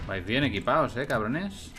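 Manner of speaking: loud, high mocking jeer at the enemies; loud taunting. Real, not acted. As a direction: taunt them, loud and cocky. Taunting enemies while shooting them.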